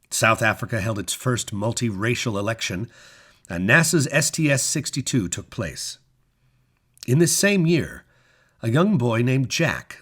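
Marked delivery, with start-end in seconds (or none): none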